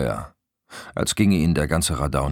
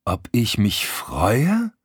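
slow, rising in disbelief; incredulous echo; 6 semitones higher, much slower than usual. A narrator's reading. Man throwing his girlfriend's question back, incredulous. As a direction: stretch it out, appalled question.

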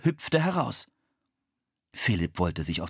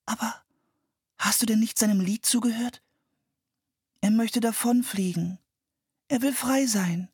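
put-on high girl's voice, earnest and pleading; pleading little girl; 14 semitones higher than usual. A narrator's reading. Anne pleads with her brother to free the bird. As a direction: beg him to listen to the bird.

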